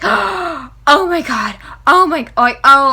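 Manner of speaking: stunned gasp. Retold or imitated. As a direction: relive the shock of the twist. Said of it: "I was like, gasps".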